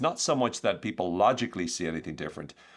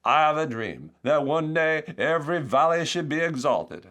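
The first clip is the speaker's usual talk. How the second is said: slow, raised preacher-like oratory; Martin Luther King; 8 semitones higher, 5 dB louder than usual. Acted. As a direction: deliver a stirring speech, drawn out and loud. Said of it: "Forgive the, the accent".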